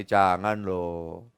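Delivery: usual speaking voice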